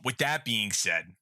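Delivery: ordinary talk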